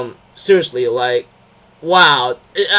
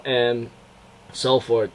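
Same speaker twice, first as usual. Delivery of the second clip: ordinary delivery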